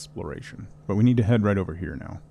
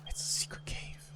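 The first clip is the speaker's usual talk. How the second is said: hushed whisper after a gasp; excited whisper; 15 dB quieter than usual. Real, not acted. Whispering to viewers about a secret cave.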